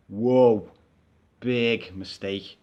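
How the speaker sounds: low, slow driver's voice; gruff bus driver. Retold or imitated. Passenger retelling the driver refusing him, low and slow. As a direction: drop low and drag it out like a stern driver.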